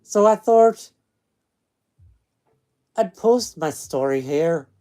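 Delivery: loud storytelling in a put-on Scottish accent; attempted Scottish accent. Streamer reads a ghost story to listeners, attempted Scottish accent. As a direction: read it out in an amateur Scottish accent. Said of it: "I could do a Scottish".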